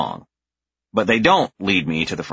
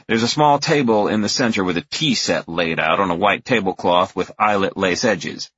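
ordinary delivery, nothing marked

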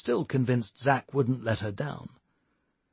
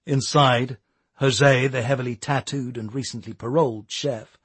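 plain reading voice

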